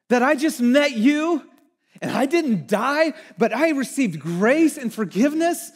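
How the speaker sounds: like Donkey begging to be picked; eager jumping up. Retold or imitated. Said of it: "this jumping up".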